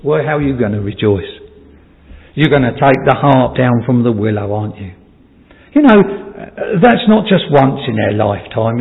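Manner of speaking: normal talking voice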